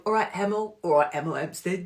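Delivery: put-on putting on an odd regional Hemel accent; muddled accent. Host attempting a Hemel Hempstead greeting, muddled put-on accent. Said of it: "I don't know what that accent was".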